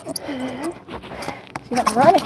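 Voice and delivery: usual speaking voice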